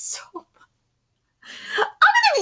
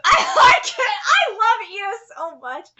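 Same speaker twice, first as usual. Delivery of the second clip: high-pitched, delighted squeal; gushing fan; 5 semitones higher, 3 dB louder, slower than usual. Real, not acted. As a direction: squeal with laughter, then gush slowly. Gushes over a cute line.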